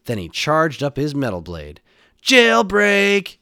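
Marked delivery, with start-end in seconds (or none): 2.2-3.4 high, very loud battle cry; full-voiced escape shout; triumphant yell. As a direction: yell it out as a charging hero. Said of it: "yelled Mega Man".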